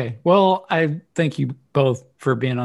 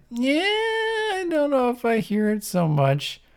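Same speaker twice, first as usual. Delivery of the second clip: high, unconvinced reply; doubtful; 13 semitones higher than usual. Retold or imitated. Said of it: "I was kinda like".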